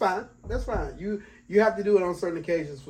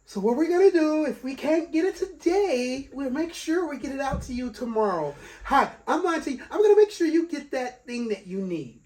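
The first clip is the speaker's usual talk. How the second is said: high, over-polite customer-service imitation; professional phone voice; 8 semitones higher, 3 dB louder than usual. Friend mimics him promising a customer delivery, professional voice. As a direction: mimic a chirpy service rep, pitched right up. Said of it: "a professional voice".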